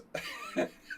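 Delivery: quick amused laugh; a quick burst of laughter; short laugh. Real, not acted. Telling chat about his three-bishop endgame, quick real laugh.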